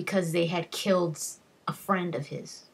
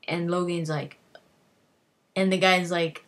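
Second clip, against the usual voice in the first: usual speaking voice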